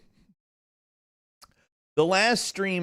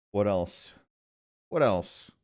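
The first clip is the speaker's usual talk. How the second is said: usual speaking voice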